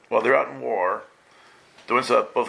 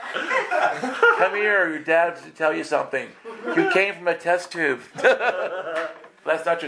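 talking through laughter, higher; laughing through words; 5 semitones higher, slower than usual. Real, not acted. Preacher laughs while joking to the kids in the hall.

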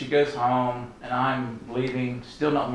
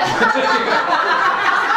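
big laugh; 7 dB louder than usual. Real, not acted. Bursts out laughing at own story.

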